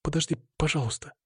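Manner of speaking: soft, understated plea with a catch in it; voice breaking. A narrator's reading. Woman begging her departing man to wait, voice lightly breaking. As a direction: plead quietly, as if the voice might give out. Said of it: "Её голос сорвался".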